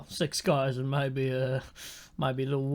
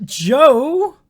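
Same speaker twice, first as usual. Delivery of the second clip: surprised; 10 semitones higher, 10 dB louder than usual. Real, not acted.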